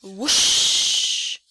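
a loud breathy whoosh of wind; windy whoosh. A narrator's reading. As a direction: blow it out like a storm gust. Snowstorm wind sound.

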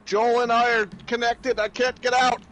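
high, playful distress call; mock plea from someone trapped; calling for help. Trapped in the game with Joel, calling for help.

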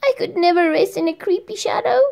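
put-on boy refusing to rest in a shadow; worried little boy. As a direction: object in a rush. Boy objects.

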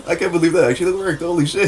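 high, loud and laughing; can't believe it worked; excited disbelief. Real, not acted. Unlikely shot lands, laughing.

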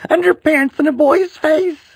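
high, loud wailing shout; mortified shout. A narrator's reading. Boy shouting about underpants, high wail. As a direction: wail it in horror.

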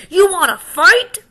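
high, loud taunt with a rising end; bully's dare. Acted. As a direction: dare him to fight. Grouchy ladybug picks fight.

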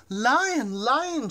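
high, loud astonished call; astonished chirp. A narrator's reading. Bird sees the orange tail, calling out high and astonished. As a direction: cry out his name in amazement. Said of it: "chirped the bird, astonished".

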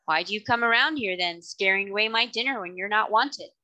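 plain reading voice

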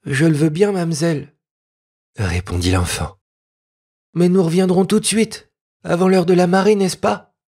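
put-on a small village boy agreeing but worried; timid peasant boy. Peasant boy answering the young lady, timid child voice.

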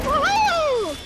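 high excited whoop; cheering. Real, not acted. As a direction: whoop with joy. Cheering in game.

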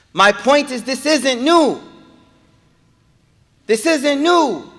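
high, loud impassioned sermon; preaching at full force. Acted. Preacher rousing the congregation, high and loud. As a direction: drive it home to the crowd.

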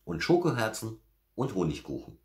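high, eager bear-cub voice; excited little bear. A narrator's reading. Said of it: "rief Berti". (hohe, begeisterte Stimme eines kleinen Bären)

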